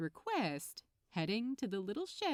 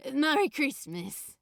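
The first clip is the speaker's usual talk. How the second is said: louder, dazed and lovestruck; dopey; 4 semitones higher, 9 dB louder than usual. A narrator's reading. Smitten young man answering, dopey and dazed. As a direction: say it in a happy daze. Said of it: "Crowley said dopily in return".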